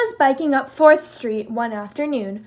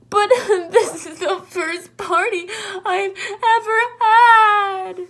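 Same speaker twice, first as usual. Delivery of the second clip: crying; 8 semitones higher, 6 dB louder than usual. Acted. Moose crying to Jackal about his first party, sobbing high. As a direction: wail through tears. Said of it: "cried Moose".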